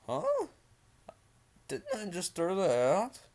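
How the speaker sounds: high, baffled question; puzzled surprise. Acted. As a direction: act out a startled double take. Narrator acts his puzzled reaction.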